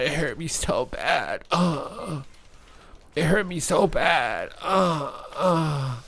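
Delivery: high, hammed-up whimpering; fake-sob over a tiny hit; mock crying. Pretending the chaos ball hurt him, with hammed-up whimpers.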